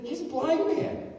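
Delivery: high-pitched, incredulous aside; mock incredulity. Voicing incredulity at Jesus' question.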